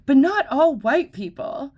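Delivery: high, loud whiny objection; hammed-up offended lady; mocking the lady.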